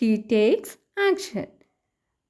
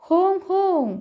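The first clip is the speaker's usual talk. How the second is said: higher-pitched honking noise; imitated bus horn; 5 semitones higher than usual. Teacher voices the bus's horn for children, imitated honking. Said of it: "Hong Hong എന്ന് sound പുറപ്പെടുവിക്കുന്നുണ്ട്".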